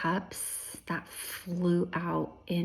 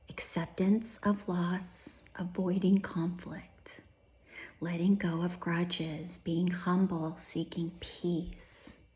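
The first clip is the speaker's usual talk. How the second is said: whispering; 4 dB quieter than usual. Real, not acted.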